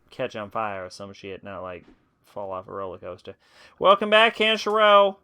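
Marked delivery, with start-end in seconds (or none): 3.8-5.2 raised voice reading game lines; character voice. Acted. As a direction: act out the game dialogue. Reads game dialogue aloud in voices.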